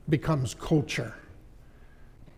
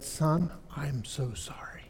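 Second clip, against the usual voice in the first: slow, soft whispered sorry; whispered apology; 4 semitones lower, 9 dB quieter, slower than usual. Retold or imitated. Father whispering apology to his son. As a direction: hush it, contrite.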